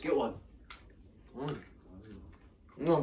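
talking between bites; chewing candy while talking. Real, not acted. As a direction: mouth partly full of candy. Seen 2: Left speaker tosses small candy into mouth, then talks while chewing.

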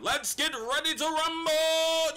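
slow, drawn-out, loud and high like a boxing ring announcer. Retold or imitated. Quoting the boxing announcer to hype the monster fight, slow and booming. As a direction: stretch it out like calling a title fight.

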